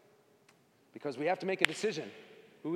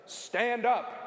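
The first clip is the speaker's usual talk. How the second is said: called out high and louder; mock-priest urging them to stand; priest calling out; 8 semitones higher, 6 dB louder than usual. Priest at mass urging everyone up, loud call.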